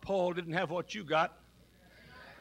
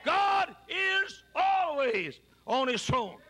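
1 slow emphatic declaration; 10 semitones higher, much slower than usual. Acted. Preacher declaring at peak.

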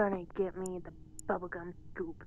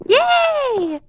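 excited cheer; 19 dB louder than usual. Real, not acted. Cheering when invited to play.

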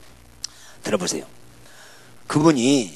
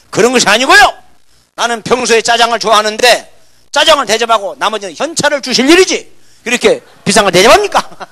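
animated protest; 7 semitones higher, 12 dB louder than usual. Retold or imitated. Retold playful protest to elder.

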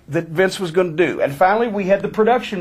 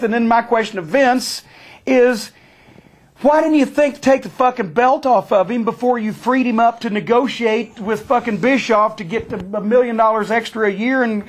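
usual speaking voice

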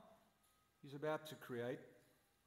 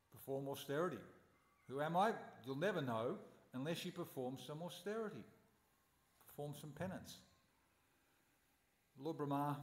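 usual speaking voice

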